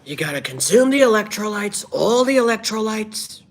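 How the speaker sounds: high, loud caricature of a politician's pitch; hammed-up, high-pitched political boasting; Donald Trump. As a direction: strain way up into the mock politician voice. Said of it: "let's do an impression".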